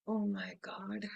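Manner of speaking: breathless whispered gasp; hushed, unvoiced oh my God. Princess whispering to herself in the cottage.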